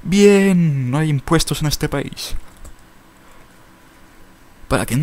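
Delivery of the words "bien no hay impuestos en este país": excited. Pretend. High excited voice.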